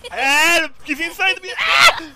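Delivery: high frantic cry for help; panicked screaming. Real, not acted. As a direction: shriek as if attacked. Enemy champion attacking her.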